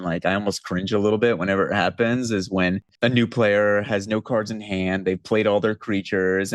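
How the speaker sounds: normal talking voice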